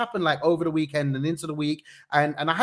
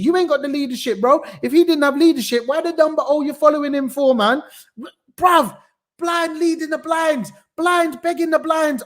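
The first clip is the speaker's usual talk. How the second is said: much higher and louder, exasperated; heated rant; 10 semitones higher, 7 dB louder than usual. Real, not acted. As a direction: let the frustration boil over. Ranting about political leadership.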